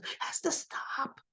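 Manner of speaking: hushed, whispered warning; whispered insistence. Retold or imitated. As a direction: whisper it as a firm plea. Acting Sonny's plea.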